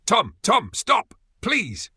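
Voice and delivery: high, loud pleading yells after a friend; desperate yelling. A narrator's reading. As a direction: scream his name as he runs away.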